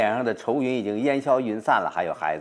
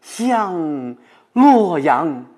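higher, very slow drawn-out line; 6 semitones higher, 4 dB louder, much slower than usual. Shown as a demonstration. Professor reciting as Du Fu longing for home. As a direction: stretch it out as the homecoming poet. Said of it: "就想象自己就是杜甫了嘛".

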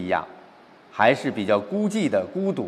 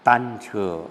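slow, understated poetry recitation; measured verse with a touch of gloom; slightly gloomy; 3 dB quieter, much slower than usual. Shown as a demonstration. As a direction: tell the situation slowly, with long pauses. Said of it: "开始的心结有点郁闷".

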